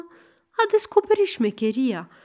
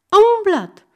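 shrill insistent cry; 10 semitones higher, 7 dB louder than usual. Acted.

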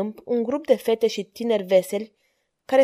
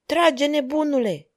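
higher, slow, drawn-out cry; shouted order; 6 semitones higher than usual. A narrator's reading. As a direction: call it out like a coachman giving an urgent order. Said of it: "îi strigă vizitiul".

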